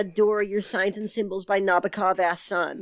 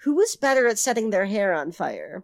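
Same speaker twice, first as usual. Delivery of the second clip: normal talking voice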